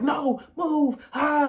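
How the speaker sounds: high, loud shove-past yell; frantic yelled refusal; angry yell. Retold or imitated. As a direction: shout it like a bleeding man brushing everyone off. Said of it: "He's like".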